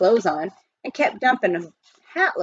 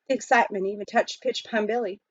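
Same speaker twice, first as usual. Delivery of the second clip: usual speaking voice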